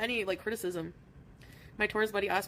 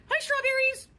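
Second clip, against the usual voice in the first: excited high greeting; 7 dB louder, slower than usual. Real, not acted. Greeting a viewer called strawberries, excited squeal. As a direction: greet them way up high and loud.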